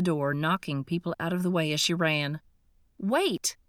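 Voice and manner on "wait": calling after someone leaving; called out. Acted. Narrator calls after the fleeing woman, a raised call. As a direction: raise the voice to stop her. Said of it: "I called".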